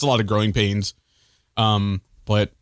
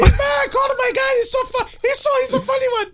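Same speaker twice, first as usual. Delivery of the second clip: fast, high mock cheering over the guest; hyped fan squeal. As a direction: gush like a screaming fan. Continuing the hyped fan bit.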